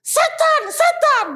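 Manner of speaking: high shrieking yell of terror; terrified scream. Retold or imitated. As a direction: scream it like you've seen a demon. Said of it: "karena teriakan orang ini". (jeritan ketakutan yang melengking)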